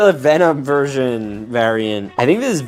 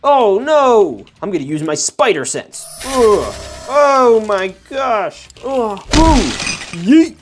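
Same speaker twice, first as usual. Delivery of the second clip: battle cries; 7 semitones higher, slower than usual. Pretend. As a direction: yelp like a figure in a toy battle. Toy fight sound effects.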